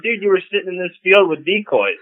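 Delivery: scoffing at him, voice jumping up; mock angry retort. Hunter snapping back at a complaining rival, mock indignant. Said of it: "this guy's bitching at me".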